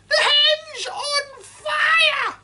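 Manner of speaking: put-on high, loud screeching old woman; cranky grandma yelling from the roof; shouting grandma. A narrator's reading. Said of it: "Grandma yelled".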